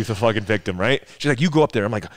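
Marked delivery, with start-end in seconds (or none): 1.4-1.8 higher and louder, imitating mom; a pushy parent sending the kid up; insistent mom. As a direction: mom insisting her son go up first. Mom urging him forward.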